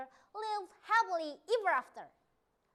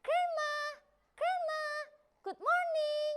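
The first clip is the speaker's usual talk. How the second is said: put-on high little-girl call; high child voice calling out; 10 semitones higher than usual. A narrator's reading. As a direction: call sweetly for grandma, higher. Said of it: "and called out".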